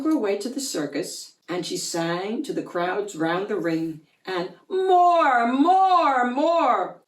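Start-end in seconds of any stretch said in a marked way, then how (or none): 4.7-7.0 loud high chant, fading; roaring crowd. A narrator's reading. Crowd demanding more, loud roar fading. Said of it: "came the deafening roar".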